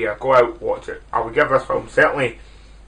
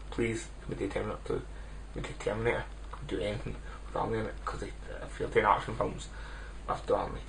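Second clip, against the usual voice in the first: much softer, half-whispered begging aside; hushed plea; 13 dB quieter, faster than usual. Real, not acted. Vlogger quietly begging host Alan for Terminator, hushed plea. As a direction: lean in and quietly beg for an Arnie film.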